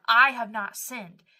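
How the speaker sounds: retold defiant denial, loud from the first word; acted insistence, punched hard; emphatic protest. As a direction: push back loudly against the accusers. Job protests his innocence to accusers.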